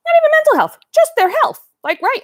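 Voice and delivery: high, loud and indignant. Real, not acted. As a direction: push up high in disbelief. Indignant defence of the athlete.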